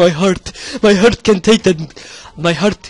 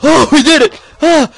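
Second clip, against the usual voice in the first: excited shout; 11 semitones higher, 5 dB louder than usual. Real, not acted. Winning round, cheering.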